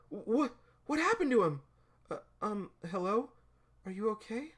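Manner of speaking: put-on high, hesitant worried girl; worried alarm. Girl checking on the fallen guy, worried. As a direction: pitch it up, anxious and faltering. Girl finds someone lying on ground.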